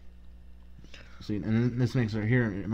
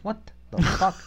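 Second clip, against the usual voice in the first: incredulous; 10 semitones higher, 4 dB louder than usual. Retold or imitated. Batman reacting in disbelief.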